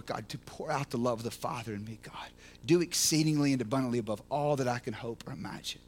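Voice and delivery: low, soft earnest prayer. Real, not acted. Pastor praying quietly to God before the sermon. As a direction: pray it quietly, low and humble.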